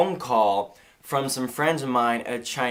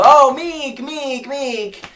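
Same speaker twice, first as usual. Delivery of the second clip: high, loud mock Chinese accent; excited Chinese restaurant staff, pitched way up; imitated excited greeting; 12 semitones higher, 9 dB louder, much slower than usual. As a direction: cry out in welcome with a Chinese accent. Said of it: "they all look up and go".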